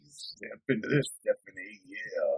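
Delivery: low, soft fake wrestler impression; Macho Man. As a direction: growl a knock-off wrestler catchphrase quietly. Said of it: "fake Macho Man".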